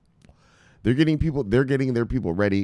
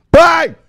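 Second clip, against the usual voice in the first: shouted bang; 13 dB louder than usual. Acted. Sound effect shout.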